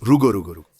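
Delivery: sharp, loud stop command to a dog; firm command. Real, not acted. Stopping excited dogs.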